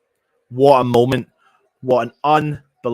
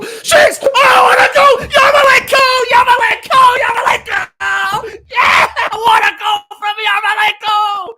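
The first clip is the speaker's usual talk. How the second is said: screaming the name, high and loud; goal celebration; 11 dB louder, slower than usual. Real, not acted. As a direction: roar it like the winning goal just went in. Goal celebration on live commentary.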